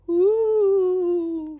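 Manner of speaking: high, loud ghost hoot; a spooky ghost call, high and loud; ghostly hoo. A narrator's reading. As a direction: moan it out like a ghost.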